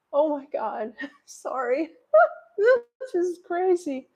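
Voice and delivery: apologizing through real tears, voice high; tearful, half-laughing, pitch breaking upward; starting to cry. Real, not acted. Streamer apologizing to viewers for tearing up, starting to cry. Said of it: "I'm gonna start crying".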